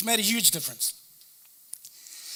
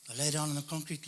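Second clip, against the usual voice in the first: ordinary talk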